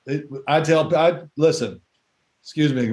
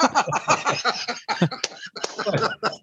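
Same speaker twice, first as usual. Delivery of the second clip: a long breathy laugh, almost silent; 7 semitones higher, 5 dB quieter than usual. Real, not acted. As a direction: wheeze with laughter at the memory. Laughing at his own story.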